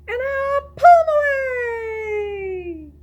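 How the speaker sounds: slow stretched cry up high; high, loud drawn-out squeal. Shown as a demonstration. Climax of action song.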